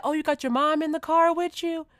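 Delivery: high, loud friendly question; chatty neighbour voice. Retold or imitated. Stranger quizzing her about who's in the car, high and loud. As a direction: ask brightly, too familiar.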